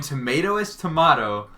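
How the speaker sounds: tomato said to-MAH-to; odd tomato. Retold or imitated. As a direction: say tomato with a broad AH, "tomahto". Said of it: "She unironically said it that way".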